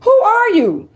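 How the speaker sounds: high, incredulous demand; shocked at what she found; baffled, accusing questions; stunned who are you. Retold or imitated. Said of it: "I'm looking at that shit like".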